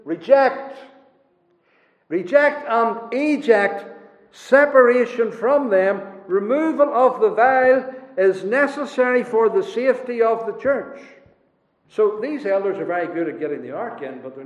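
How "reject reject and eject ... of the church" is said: high, slow, insistent preaching; forceful preaching. Real, not acted. Preacher urging the church to eject heretics, forceful preaching. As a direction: drive each demand home at a raised pitch.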